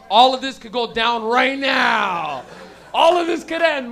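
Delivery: loud, drawn-out dread; hammed-up panic at getting caught; nervous alarm. Comic recalls smoking at parents' house, loud mock panic. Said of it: "that nervous feeling where you're like".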